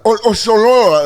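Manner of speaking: high, drawn-out mock Dutch babble. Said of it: "they're speaking in Dutch".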